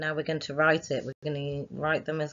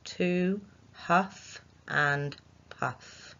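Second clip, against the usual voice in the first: "to huff and puff" very slow, word by word; slow dictation; 2 semitones higher, much slower than usual. Shown as a demonstration. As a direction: dictate each word slowly. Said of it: "four words in that sentence".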